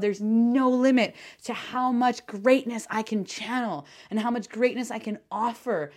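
ordinary talk

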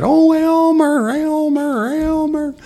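high, loud, slow sing-song calling of Elmer; crazy lady calling. Retold or imitated. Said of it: "she comes in with the big pot".

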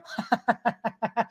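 high, quiet, rapid-fire chuckle; self-mocking chuckle. Real, not acted. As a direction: chuckle at your own boast. Laughs at own boast of creativity.

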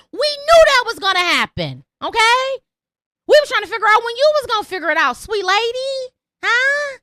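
mocking. Real, not acted. Mocking rant to listener.

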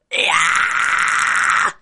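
loud, distorted high metal scream; high fry scream. Shown as a demonstration. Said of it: "it's gonna go higher".

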